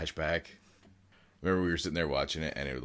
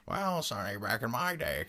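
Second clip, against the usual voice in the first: put-on higher old-timer voice; very old man; 8 semitones higher than usual. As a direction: play a doddering grandpa. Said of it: "he went to the old man voice".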